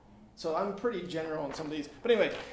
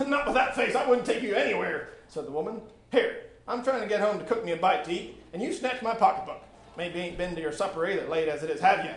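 stern but motherly woman; motherly scold; 3 semitones higher, 5 dB louder than usual. Acted. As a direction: chide him like a mother. Woman answers boy about jail.

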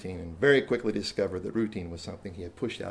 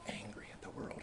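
hushed; 19 dB quieter than usual. Real, not acted. Describing an angry boy.

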